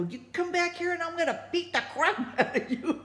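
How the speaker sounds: high, fast threat breaking into laughter; mock angry threat. Calling after the fleeing guys, high laughing threat. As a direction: yell after them, laughing at yourself. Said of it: "I was so mad".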